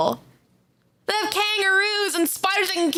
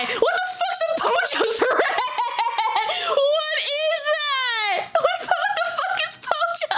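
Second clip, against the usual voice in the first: high, laughing half-shout of confusion; laughing disbelief; 12 semitones higher than usual. Real, not acted. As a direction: crack up while demanding an answer. Baffled by the word Pojo.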